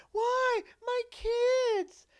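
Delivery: put-on high, loud whining "Why? My kids"; mock wail. Mother pleading about her kids, high wailing. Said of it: "friend's desperate wail".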